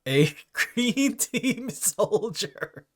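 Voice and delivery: giggling through the words; laughing. Real, not acted. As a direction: laugh as you read it out. Laughing while reading item.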